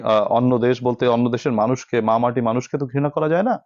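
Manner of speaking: ordinary delivery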